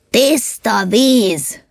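high, indignant holler; shouting back. A narrator's reading. Fed-up elf on the box shouting back at the ideas. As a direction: shout back high, stretching the first words. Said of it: "annál hangosabban kiáltott vissza".

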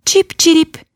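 very high, thin birdlike chirp; bird chirp. A narrator's reading. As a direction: chirp it like a small sad lark. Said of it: "mondta a madár". (magas, vékony madárcsipogás)

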